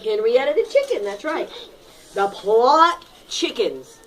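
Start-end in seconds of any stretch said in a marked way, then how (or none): none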